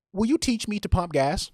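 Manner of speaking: put-on very high, loud Chinese woman's accent; imitated Chinese accent, high and loud.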